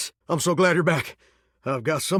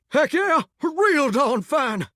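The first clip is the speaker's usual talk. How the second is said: high, drawn-out jubilation; jubilant, high-pitched hooray; elated cheer; 8 semitones higher, much slower than usual. Acted. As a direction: crow it high and stretch each word. Celebrates the good news.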